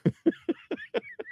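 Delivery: short high-pitched laugh; a quick squeaky laugh at his own joke. Real, not acted. Laughing at the critic after mocking his words.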